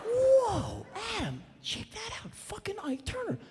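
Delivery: high, hushed and thrilled, nudging a friend; excited whisper-shout. Retold or imitated. Nudging [name] at the party, thrilled and hushed. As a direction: hiss it out, star-struck. Spotting [name] at party.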